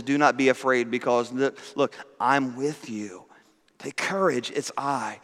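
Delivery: calm frightened friends in the storm; tender reassurance. Acted. Jesus's words, voiced tenderly.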